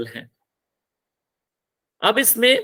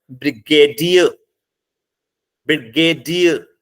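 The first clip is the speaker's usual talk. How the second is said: Brigadier broken into slow syllables, dear stressed; slow model brigadier, weight on dear; stress on last syllable; 5 dB louder than usual. Shown as a demonstration. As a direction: hit the final dear in brigadier. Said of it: "dear पे stress लगाएंगे".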